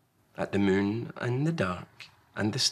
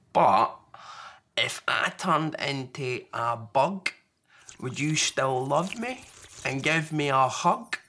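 put-on slow, higher child voice; Small, questioning little one; 4 semitones higher than usual. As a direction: wheedle for a hug. Small asks Large.

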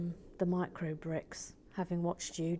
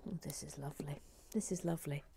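whisper; 3 semitones lower, 9 dB quieter, faster than usual. Real, not acted. Whispered delight.

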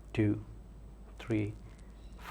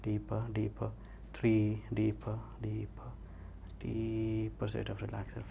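slow, drawn-out count sinking softer; hypnotic induction; 5 dB quieter, much slower than usual. Real, not acted. Hypnotist counts subject into relaxation.

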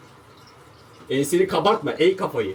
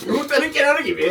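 mocking echo; 11 semitones higher than usual. Mocking friend's words.

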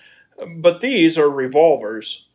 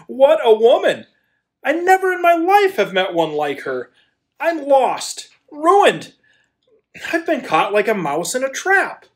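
overwhelmed outburst; 9 semitones higher than usual. Acted. Smitten man crying out in wonder about the woman. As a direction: blurt out your helpless amazement.

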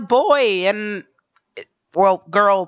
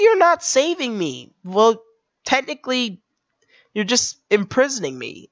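ordinary talk

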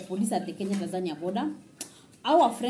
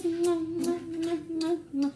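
high-pitched repeated kiss sounds; blown kisses; 7 semitones higher, slower than usual. Real, not acted. Vlogger signing off to viewers, blowing high kisses. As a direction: blow a string of playful kisses. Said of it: "Blow kisses".

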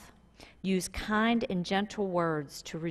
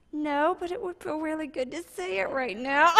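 laughing; 7 semitones higher than usual. Retold or imitated. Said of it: "I'm like".

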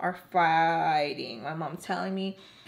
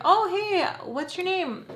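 high, chirpy greeting; mock sweet adult welcome; cheery parents; 10 semitones higher than usual. Parents at the party greeting her brightly.

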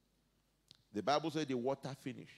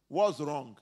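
laughing it out, louder; a laughing, louder echo of the question; 9 dB louder than usual. Acted. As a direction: chuckle through the question. Repeats the verse with a laugh.

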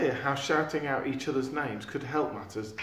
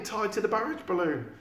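plain reading voice

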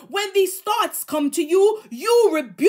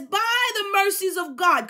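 higher, pleading cry of the apostle; 4 semitones higher than usual. Retold or imitated. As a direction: beg them, by the mercies of God. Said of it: "So he's pleading and he says".

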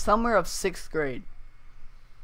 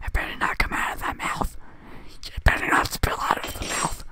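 ASMR whisper; 9 semitones higher than usual. Acted. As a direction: start hushed, then whisper harder. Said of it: "I'm doing the A S M R session".

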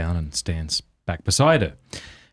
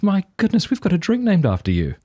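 much higher, excited bartender; 11 semitones higher than usual. Retold or imitated. Bartender greets the screwdriver, higher excited voice. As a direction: gush like a surprised bartender.

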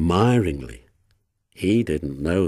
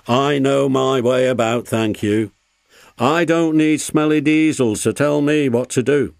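raised, loud, huffy voice; huffy retort; 6 semitones higher, 5 dB louder than usual. A narrator's reading. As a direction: brush off the advice rudely. Duncan rudely rejects the warning.

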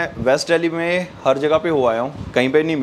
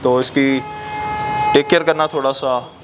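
muffled behind mouth guard; speaking under goggle mask; through a face mask. Real, not acted. Seen 0: Moto goggles with attached mouth guard cover mouth throughout the words.

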